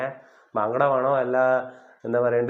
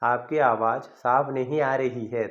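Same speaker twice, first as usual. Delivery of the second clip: slow demo; slower than usual. Shown as a demonstration. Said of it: "എന്നാണ് അതിൻ്റെ അർത്ഥം".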